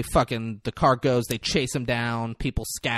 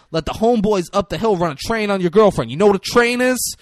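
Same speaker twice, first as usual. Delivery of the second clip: rising, louder threat; crooked cop threatening the kids; mock menacing cop; 6 semitones higher, 6 dB louder than usual. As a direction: lean in and scare them.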